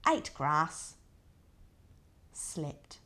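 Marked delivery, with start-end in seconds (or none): 2.3-3.0 drowsy near-whisper, nodding off; sleepy whisper. Acted. Wombat drifting to sleep, whispering. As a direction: breathe it out, barely voiced.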